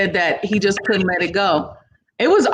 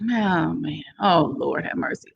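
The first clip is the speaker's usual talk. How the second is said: softer, fading groan of worry; mock dread sinking to a murmur; 2 semitones lower, 5 dB quieter than usual. Reluctant owner dreading trouble, fading into a soft mock groan. Dreading the old house drama.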